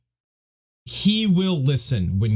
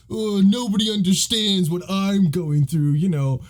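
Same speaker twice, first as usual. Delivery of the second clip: high-pitched mock teenage whining; whiny teenager; 8 semitones higher than usual. Teen Jon complaining to the old maester, whiny. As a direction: whine it like a misunderstood teenager. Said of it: "such a typical whiny teenager".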